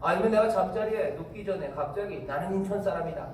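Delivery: ordinary talk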